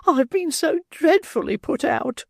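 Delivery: very high, slow fluttering lady; distressed matron. Acted. Matron complaining to the beadle, high and slow. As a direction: fret in a high lady's voice.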